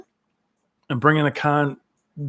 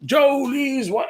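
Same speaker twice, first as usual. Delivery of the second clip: higher, slow mock McGregor taunt; imitating McGregor mouthing off, higher and slow; 11 semitones higher, slower than usual. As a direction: ham up a brash fighter's jeer. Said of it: "he's up there like".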